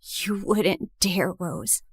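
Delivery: a cold dare under the breath; low quiet threat. Acted. Jessica dares Rose not to point at her, low slow threat. Jessica faces being taken.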